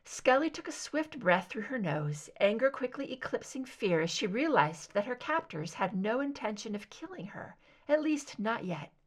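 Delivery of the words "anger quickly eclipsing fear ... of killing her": ordinary delivery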